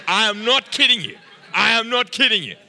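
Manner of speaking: "I am not kidding you" high and loud; emphatic insistence. Real, not acted. Insists his story is true.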